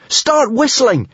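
high-pitched, drawn-out urgent shriek. A narrator's reading. Hiccup urges the others to whistle, shrieking. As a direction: shriek it out as an order. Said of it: "shrieked Hiccup".

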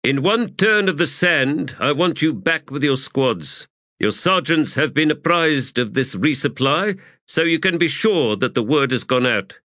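officer briefing a whole company. A narrator's reading. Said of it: "and then raised his voice".

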